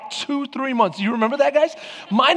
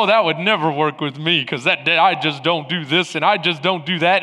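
low, lightly self-satisfied mock voice; a touch of puffed-up pride, pitched down; 7 semitones lower than usual. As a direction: play someone mildly full of themselves. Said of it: "you get puffed up and go".

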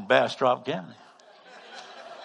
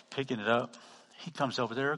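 normal talking voice

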